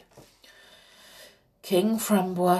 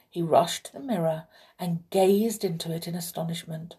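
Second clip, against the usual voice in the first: usual speaking voice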